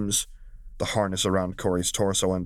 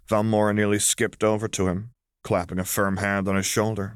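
plain reading voice

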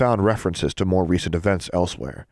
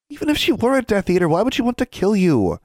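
high-pitched protesting question; girl's insistent, incredulous challenge; persisting; 14 semitones higher, 3 dB louder than usual. Acted. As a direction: keep arguing, voice pushed well up. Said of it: "Anna persisted".

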